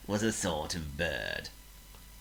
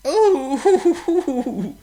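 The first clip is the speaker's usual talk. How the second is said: much higher, louder groaning hoots; a hurt bird moaning; comic groan; 12 dB louder than usual. Acted. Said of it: "Chirp groaned".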